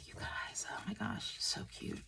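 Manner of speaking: hushed whisper over something cute. Real, not acted. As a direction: whisper it like you can't contain yourself. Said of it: "I just have to whisper".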